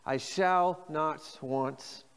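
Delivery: each word drawn out slowly; very slow call and response. Shown as a demonstration. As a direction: lead the congregation slowly. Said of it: "Say that with me".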